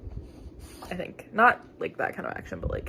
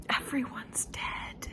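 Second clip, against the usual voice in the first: dramatic whisper; 4 semitones higher, 9 dB quieter, slower than usual. Real, not acted. Reader stunned by deaths, gasping whisper. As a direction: gasp then hushed whisper, slow. Dramatic hushed book reaction.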